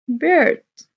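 high, loud model word for the class; emphatic prompt. Shown as a demonstration. Teacher to pupils, calling out bird loud and high. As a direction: belt out bird so the kids copy. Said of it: "شو العصفور؟".

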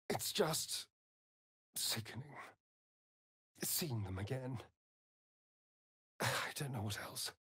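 near-silent heavy words; hushed and pained. Acted. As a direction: murmur it as if ashamed. Reluctant, hushed confession.

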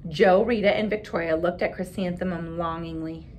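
put-on expressive read-aloud for children; story narration. A narrator's reading. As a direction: read the picture book to the class with voices. Reads picture book aloud.

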